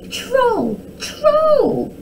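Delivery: high, drawn false-alarm call; shouted alarm. A narrator's reading. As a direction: call out as if at full voice. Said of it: "at the top of her voice".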